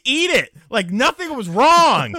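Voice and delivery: high and loud, recoiling in disgust; disgusted outburst. Real, not acted. Telling cohosts about the cottage cheese sneeze, disgusted and loud. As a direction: build to a revolted squeal.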